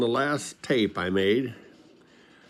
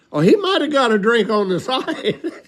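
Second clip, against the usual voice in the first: high-pitched, chuckling cover-up; retold high-voiced lame excuse; sheepish excuse; 8 semitones higher than usual. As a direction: play an innocent excuse up high, breaking into a laugh. Harry makes excuse to mom.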